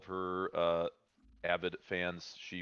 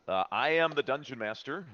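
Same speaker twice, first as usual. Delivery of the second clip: ordinary talk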